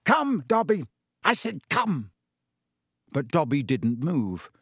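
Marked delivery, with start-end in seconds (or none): 0.1-2.1 commanding shout. Acted. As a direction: snap the command at the servant. Master orders elf.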